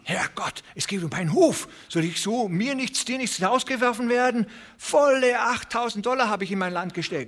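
a farmer fearing to lose his farm; verzweifelter Bauer. A narrator's reading. Desperate farmer voiced. (viel höher, verzweifelt)

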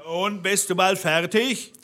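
mild storybook call up the stairs, pitched high; calling up from below. A narrator's reading. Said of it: "Da rief der Blaubart unten".

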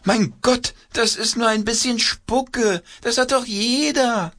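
very high exasperated puppet voice. A narrator's reading. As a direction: sigh it out as an impatient puppet.

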